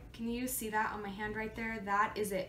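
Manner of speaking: usual speaking voice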